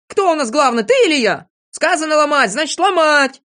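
high, loud commanding rant; indignant, domineering shout; bossy anger. A narrator's reading. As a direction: lay down the law loudly. Незнайка asserts command in quarrel.

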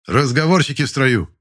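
loud, high shout at the ranks; sharp rebuke. Acted. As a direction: snap at the talkers in formation. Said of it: "окрик командира". (резкий окрик командира)

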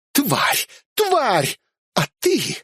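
angry blonde yelling curses; furious woman. Acted. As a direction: let her rage boil over.

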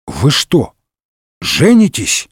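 halting, stunned question; dumbfounded. A narrator's reading. D'Artagnan learns of the wedding, taken aback. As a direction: pause in disbelief before the last word. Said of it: "Д'Артаньян опешил".

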